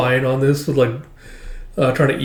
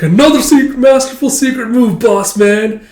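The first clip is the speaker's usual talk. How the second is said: put-on much higher, louder kid hype; excited kid voice; 10 semitones higher, 6 dB louder than usual. Kid cheering on a friend's secret move, high and excited.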